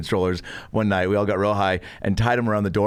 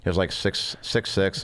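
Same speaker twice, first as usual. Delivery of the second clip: low, subdued impression of the roommate; imitating roommate's voice; 3 semitones lower, 4 dB quieter than usual. Introducing Blake to friends, lightly imitating his voice. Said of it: "He talked like this".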